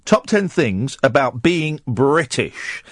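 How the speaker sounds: ordinary talk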